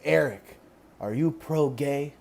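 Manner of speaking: put-on soft, slow mom with a light Filipino accent; thick Filipino accent. Conservative mom questioning her son, slow light Filipino accent. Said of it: "she has a really thick accent".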